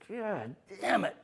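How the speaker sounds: retold angry curse; angry vet. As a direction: mimic the vet cursing as he flicks the cigarette. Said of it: "just threw it down and said".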